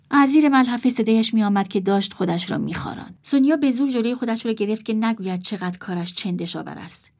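usual speaking voice

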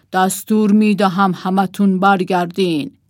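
loud royal order in a put-on voice; shouted command. A narrator's reading. King left alone orders everyone back, shouting. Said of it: "فریاد زد".

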